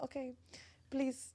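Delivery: lightly imitating her own voice with a busboy; soft accent match. Host quoting herself at a restaurant, soft and laughing. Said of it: "I'm like".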